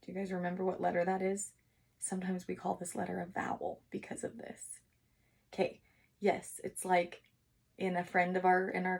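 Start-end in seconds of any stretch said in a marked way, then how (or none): none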